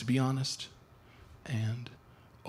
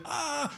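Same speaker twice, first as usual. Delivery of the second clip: polite quiet scream; 13 semitones higher than usual. Retold or imitated. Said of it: "polite, quiet scream that girls do".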